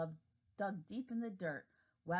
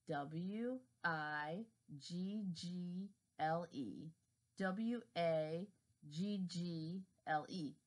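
slow spelling out; much slower than usual. Acted. Worm reads letters aloud.

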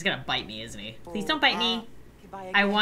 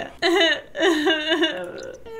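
laughing sob; 4 semitones higher than usual. Real, not acted. Trading away her beloved cow.

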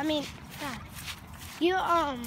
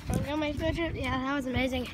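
normal talking voice

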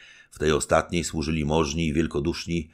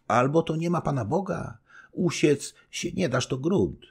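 higher, doting wifely voice; fond young wife; 12 semitones higher than usual. Acted. Young wife telling her husband she never worries, doting. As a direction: reassure him, proud of him.

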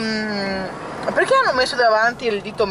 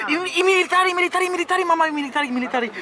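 emphatic italian speech; 8 semitones higher than usual. Real, not acted. Excited sighting of the Duomo.

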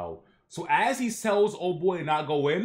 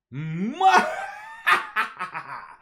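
shrill laugh; 4 dB louder than usual. Acted. Villain holds the grandson over gramps, shrill laughing. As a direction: burst into a showy shrill laugh. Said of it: "starts laughing shrill".

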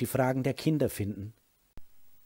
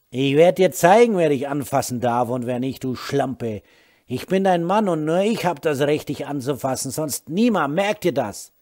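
loud threat; 3 semitones higher, 8 dB louder than usual. Acted. Husband threatens wife.

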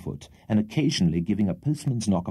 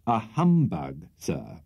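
slow, weighted repeat of humbug; emphatic; 2 semitones lower, slower than usual. A narrator's reading. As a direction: lean hard on humbug. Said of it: "low but distinct and emphatic tone".

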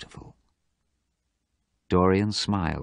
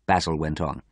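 usual speaking voice

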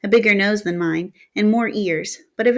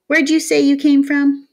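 higher, louder teasing question; a cocky town kid pressing him, louder; pushy town boy; 7 semitones higher, 6 dB louder than usual. Acted. Town boy grilling the stranger, higher and louder.

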